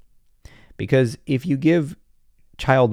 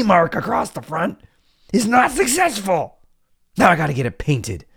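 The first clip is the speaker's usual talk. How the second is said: high, ranting mock outrage; indignant car owner; 7 semitones higher than usual. Adult ranting about his keyed Mercedes, high mock outrage. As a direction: rant like a status-obsessed adult. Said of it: "He went".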